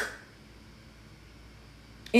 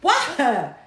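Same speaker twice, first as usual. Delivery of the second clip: exasperated self-questioning; 6 semitones higher than usual. Retold or imitated. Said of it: "'Cause sometimes I be like".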